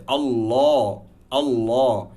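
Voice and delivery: আল্লাহ with a full, rounded "Allaw"; heavy "law". Shown as a demonstration. Teacher demonstrating the rule, heavy l in আল্লাহ. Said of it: "তাহলে কিন্তু ল হয়".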